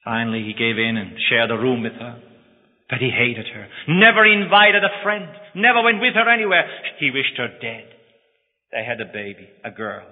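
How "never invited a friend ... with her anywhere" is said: louder and higher, hammer each never; emphatic outburst. A narrator's reading. Preacher stressing the husband's coldness, loud and emphatic. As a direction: spit out the resentment in both lines. Hatred of wife in story.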